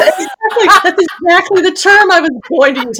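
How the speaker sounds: high-pitched laughing through the words. Real, not acted. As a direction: crack up while you agree. Laughing at the throbbing-member joke.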